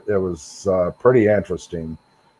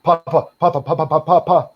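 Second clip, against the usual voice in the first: put-on much higher, urgent, a boy running back scared; frightened calling; 8 semitones higher than usual. Grandson running back to his grandpa after the sighting, frightened urgent calling. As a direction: call for Papa over and over in fright.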